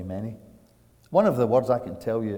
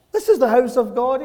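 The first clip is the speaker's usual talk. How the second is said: high, loud, astonished quote; amazed exclamation; 13 semitones higher, 9 dB louder than usual. Retold or imitated. Preacher voicing Jacob in the desert, amazed exclamation. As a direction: lift it high as if just discovering it.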